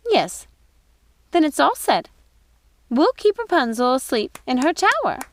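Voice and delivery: a gleeful wicked witch; Ruby's voice. Acted. Ruby tells Gothel to just kill the old woman. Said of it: "said Ruby".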